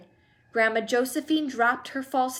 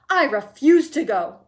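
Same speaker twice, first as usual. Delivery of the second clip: much louder, gruff declaration; announced; 3 semitones higher, 9 dB louder than usual. A narrator's reading. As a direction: pronounce it as final. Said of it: "announced Grandpa George".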